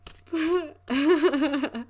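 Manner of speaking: bright burst of laughter. Real, not acted.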